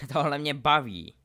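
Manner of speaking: short laugh into a loud exclamation; laugh, then crow with enjoyment; laughing delight. A narrator's reading. Draco enjoys duelling Harry, laughing. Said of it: "smál se Draco".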